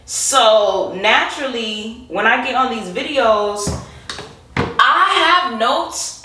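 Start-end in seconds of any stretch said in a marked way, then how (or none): 4.5-6.2 slow, high, stretched out; high drawn-out voice. Pretend. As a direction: draw it out up high. Exaggerated slow high delivery.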